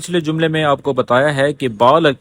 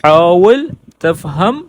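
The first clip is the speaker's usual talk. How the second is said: slow model phrase; 3 dB louder, much slower than usual. Shown as a demonstration. Teacher modelling Arabic for students, slow and clear. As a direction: say حاول تفہم slowly, word by word.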